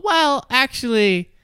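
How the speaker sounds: high, slow, loud mock pedantry; smart-aleck correction. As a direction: draw it out like a know-it-all butting in.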